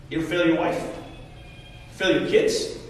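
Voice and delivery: usual speaking voice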